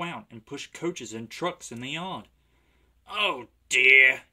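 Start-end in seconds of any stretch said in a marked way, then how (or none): 3.1-4.2 loud, drawn-out sorrowful sigh of words; sad. A narrator's reading. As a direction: lament it slowly and heavily. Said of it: "he thought sadly".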